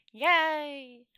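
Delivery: loud, high happy cheer. Real, not acted. The reader finishing the chapter for viewers, loud cheer. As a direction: celebrate the end with a yay.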